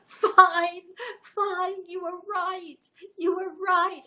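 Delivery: high, tearful, breaking voice; crying. Retold or imitated. Daughter crying to her mum before the cesarean, tearful. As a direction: sob out the defeat as the tears come. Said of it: "she starts crying".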